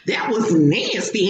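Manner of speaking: ordinary delivery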